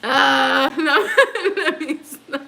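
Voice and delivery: high giggling while trying to stop; laughing. Real, not acted. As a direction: laugh helplessly, then try to calm down.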